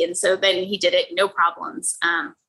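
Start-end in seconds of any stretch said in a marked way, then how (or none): none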